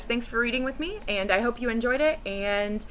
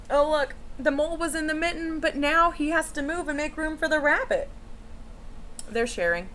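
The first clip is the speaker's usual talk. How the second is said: ordinary delivery, nothing marked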